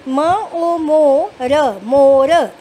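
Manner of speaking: teacher drawing out each letter loudly; slow loud spelling. Shown as a demonstration. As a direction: stretch every sound for young readers. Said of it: "आपने finger साथ साथ रखनी है".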